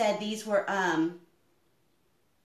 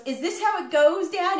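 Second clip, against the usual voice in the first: put-on little boy voice; 8 semitones higher, 6 dB louder than usual. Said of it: "and he goes".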